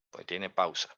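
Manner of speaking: ordinary talk, nothing marked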